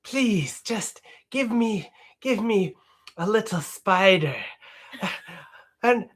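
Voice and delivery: high pleading madman voice; Renfield. Pretend. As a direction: beg like a groveling lunatic servant. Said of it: "can you do a line or a scene".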